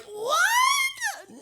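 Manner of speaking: very high, squeaky overwhelmed reaction; mock tearful disbelief. Told they will cover the birthday trip, mock-tearful disbelief. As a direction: act moved to tears, voice shooting up. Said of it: "I was crying, literally tears down my eyes".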